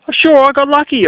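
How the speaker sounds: sarcastic. Real, not acted. Sarcastic concession in rant.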